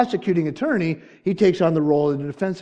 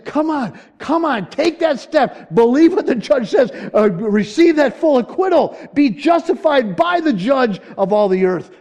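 high, loud, impassioned appeal; urgent pleading; 9 semitones higher, 7 dB louder than usual. Acted. Holy Spirit works with the lost, urgent pleading. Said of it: "how the Spirit of God must plead".

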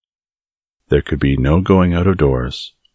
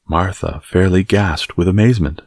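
plain reading voice

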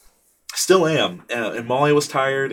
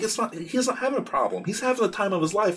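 self-correcting realization; 10 semitones higher than usual. Retold or imitated. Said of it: "I'm like".

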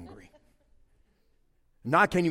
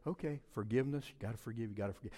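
low, soft talk to oneself; self-coaching; 6 semitones lower, 8 dB quieter, faster than usual. Acted. Dad reminding himself to forgive his kid, quietly. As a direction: trail down into a private reminder.

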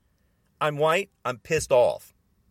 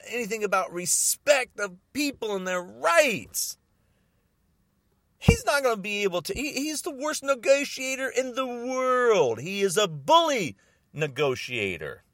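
normal talking voice

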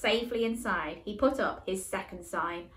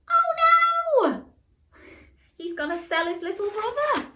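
shocked gasp; 14 semitones higher, 7 dB louder than usual. Real, not acted. Host gasps at twist.

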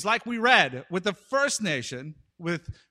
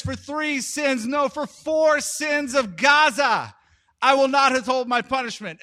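yelled proclamation; 6 semitones higher, 6 dB louder than usual. Retold or imitated. Amos denouncing Gaza to a cheering crowd, yelling. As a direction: proclaim it like a fired-up prophet. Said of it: "he goes on like this".

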